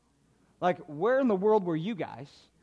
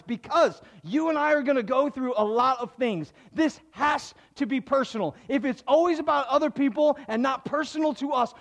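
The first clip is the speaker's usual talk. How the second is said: louder, higher, driving sermon delivery; full-force preaching; 9 semitones higher, 6 dB louder than usual. Acted. Pastor urging the congregation to pray personally, loud and fervent. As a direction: push it out like a pastor building the point.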